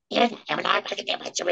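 high squeaky creature voice; creature gibberish voice. Retold or imitated. Creature begging the giant for its cheese, high and squeaky. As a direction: beg like a little creature for the cheese. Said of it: "just make something up in that voice in gibberish".

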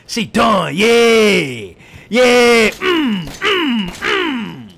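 high, loud victory cheer; triumphant shout. Real, not acted. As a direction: cheer the win. Boss defeated celebration.

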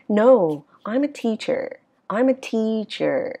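"teacher" slides down, then stretched out; falling statement tune. Shown as a demonstration. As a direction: glide down through "teacher". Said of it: "nó trượt xuống". ("teacher" trượt xuống cuối câu)

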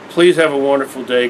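ordinary talk

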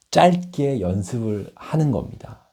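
ordinary talk, nothing marked

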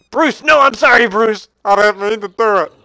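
loud, high-pitched panicked pleading; frantic apology. Real, not acted. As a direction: beg the pet's forgiveness in alarm. Accidentally hurt his wolf Bruce.

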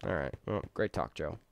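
normal talking voice

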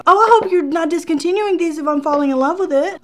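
high, loud worried gush; excited plea. Real, not acted. Pleading with the store, excited.

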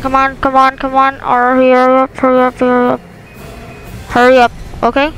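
loud urgent yelling; frantic shouting. Real, not acted. Urging game during chase.

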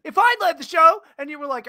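high, loud comic outburst; exasperated shout. Pretend. Co-host arguing over who leads, shouting in exasperation. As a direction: yell it in mock outrage. Mock quarrel over running the show.

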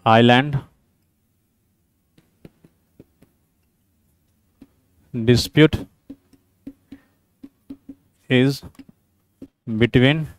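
low, soft, word-by-word dictation; slow dictation. Real, not acted. Teacher reading out a quiz statement for students, slow dictation. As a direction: dictate slowly with long gaps between words.

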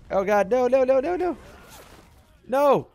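high, loud panicked no's; real panic as the attack lands; screaming no over and over. Real, not acted.

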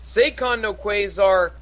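normal talking voice

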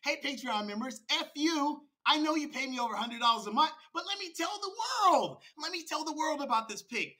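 high, loud mocking impersonation; mock a brazen rival addressing paying members; Archie. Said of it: "Archie says".

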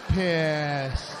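PISSED stretched very long; drawn-out emphasis. Real, not acted. Comic describing wife's look, PISSED dragged out slowly. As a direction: linger heavily on PISSED. Drawing out how mad she looks.

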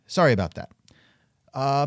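ordinary talk

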